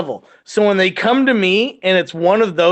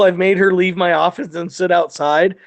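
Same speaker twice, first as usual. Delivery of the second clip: ordinary talk, nothing marked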